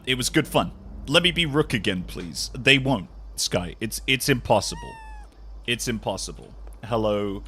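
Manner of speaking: ordinary talk, nothing marked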